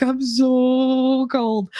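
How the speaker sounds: slow, drawn-out shivery whine; whiny shivering. Retold or imitated. Telling friends about being freezing, slow shivery whine. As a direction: stretch it out like you're freezing.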